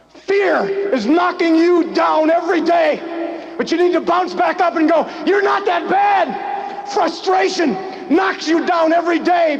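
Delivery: full-force motivational preaching. Acted. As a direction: fire the crowd up.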